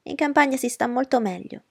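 mother triumphant over Darcy. Acted. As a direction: press the victory home. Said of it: "continuò trionfante".

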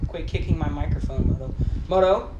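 ordinary talk, nothing marked

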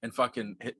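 normal talking voice

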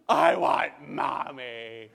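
put-on loud, very slow toddler wail; wailing child. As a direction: imitate a kid bawling for a parent. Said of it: "And then she goes".

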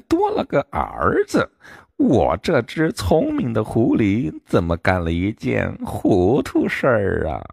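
a fox sighing over its own folly; 狐狸自言自语，无奈; fox talking to itself. A narrator's reading. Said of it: "一边自言自语".

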